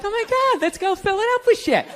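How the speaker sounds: put-on excited girlfriend.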